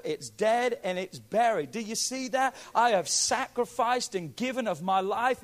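ordinary talk

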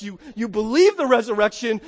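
ordinary talk

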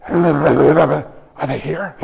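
low, very slow, garbled madman; [name] acting crazy. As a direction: slur it like a drooling lunatic. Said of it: "Can you talk right?".

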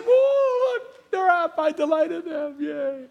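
a high, excited whoa that settles into joy; delighted God. Acted. God watching someone wake, gleefully delighted. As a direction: play God thrilled to see you wake up. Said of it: "He said".

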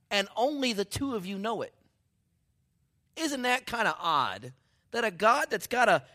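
high, loud incredulous questioning; worked-up preacher in loud wonder; amazed preaching. Real, not acted. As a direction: marvel loudly at how odd this is. Preacher marvels at the verse.

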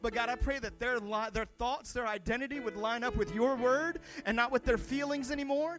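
building prayer. Acted. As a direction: pastor prays freedom over the congregation, rising fervor. Pastor prays over the congregation.

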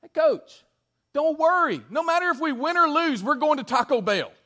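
put-on high, loud, breezy kid voice; high carefree player voice, brushing off the coach; carefree kid point guard. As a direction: play the unbothered boy reassuring his coach. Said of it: "he comes by me and he says these words".

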